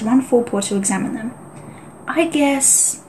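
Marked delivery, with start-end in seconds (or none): 2.1-3.0 lightly voiced lazy tom, starts higher; a hint of a cocky rogue cat; rogue tom mewing. A narrator's reading. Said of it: "he mewed".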